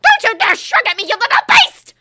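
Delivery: very high, very loud shriek. A narrator's reading. Umbridge shrieking at Ron for shrugging. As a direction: shriek it in outrage. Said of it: "Umbridge shrieked".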